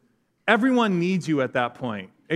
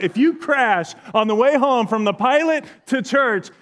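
mock angry threat; 5 semitones higher, 5 dB louder than usual. Mock threat to prankster friend.

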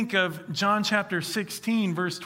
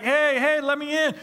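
higher, louder jokey call through a door; mock knocking at door; 7 semitones higher, 3 dB louder than usual. Pastor joking that Jesus knocked at the door, playful call. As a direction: pretend to pound and call to be let in.

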